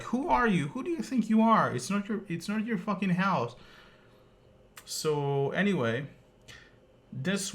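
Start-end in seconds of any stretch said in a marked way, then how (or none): 0.0-3.5 outraged rant. Real, not acted. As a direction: let the outrage climb in pitch.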